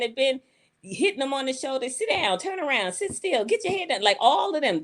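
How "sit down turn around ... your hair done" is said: much higher mock scolding mother. Mothers scolding daughters between their knees, much higher mock scolding. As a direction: nag it like a mama doing braids.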